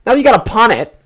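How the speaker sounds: high, loud gripe at the team; exasperated. Real, not acted. Fan after a failed drive, exasperated.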